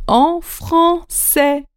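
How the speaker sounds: deliberate, separated syllables on "en français"; slow emphatic stress. Real, not acted. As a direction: spell out "en français" very slowly. Teacher hammering the rule.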